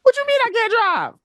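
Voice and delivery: very high, incredulous mock wail; indignant player impression. Caster voices the car's owner, high-pitched mock outrage. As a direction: shoot way up in hammed-up disbelief.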